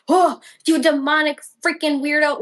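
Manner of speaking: high, mock-appalled outburst; hammed-up shocked accusation; mock horror. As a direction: imitate someone recoiling in judgment, voice up. Imagined horrified reaction, which he didn't give.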